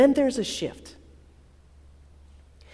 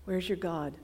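quiet ache; 4 semitones lower, 10 dB quieter than usual. Acted. Quiet repeat of taunt.

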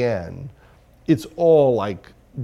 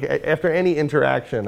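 ordinary delivery

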